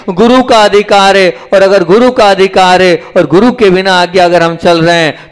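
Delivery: high, loud sermon delivery; emphatic, high-pitched exhortation; forceful preaching. Real, not acted.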